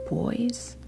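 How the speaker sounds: ordinary talk, nothing marked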